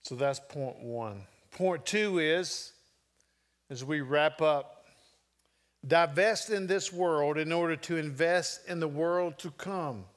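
ordinary talk

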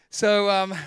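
ordinary delivery

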